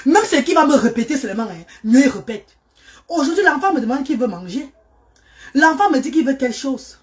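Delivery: ordinary delivery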